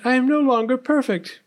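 high, loud lament; spoiled princess near tears, pitched high; dismayed princess. A narrator's reading. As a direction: wail over lost perfection. Princess dismayed, about to weep.

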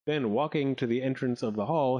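ordinary delivery

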